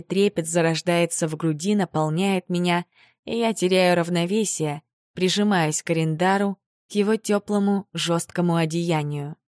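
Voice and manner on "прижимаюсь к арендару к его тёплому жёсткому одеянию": plain reading voice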